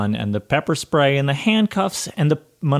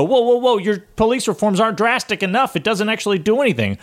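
mocking conservatives; 10 semitones higher than usual. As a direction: squeal it like an outraged hypocrite. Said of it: "And they go".

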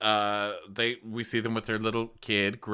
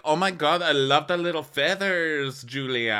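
high, louder gushing praise; mock gushing; 8 semitones higher, 4 dB louder than usual. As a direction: gush over the feathers. Quoting Adriana.

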